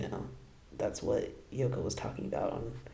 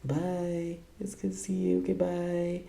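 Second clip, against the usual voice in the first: high mock goodbye; 5 semitones higher, slower than usual. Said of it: "He's like".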